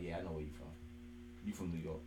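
a low, soft mocking reply. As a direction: mutter it down low. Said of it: "He'd be like".

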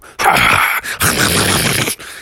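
low, rasping cackle; evil monster laugh. Acted. Cave voice laughs after threat.

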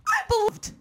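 lightly voiced high diva. Lightly voiced high diva scolding fans. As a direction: toss it off like a star. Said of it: "And I'm like".

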